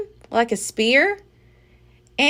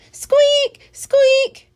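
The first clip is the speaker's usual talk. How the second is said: high, loud squeaky squeaks; tiny pet mouse squeaking at a monkey; mouse yelling; 8 dB louder than usual. A narrator's reading. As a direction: squeal like an angry little mouse. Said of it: "She seemed to be yelling".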